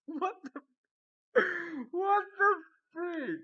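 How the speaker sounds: very high, loud disbelief, slow; slow, very high shocked squeal; shocked what the freak. Real, not acted. Reacting to confusing message.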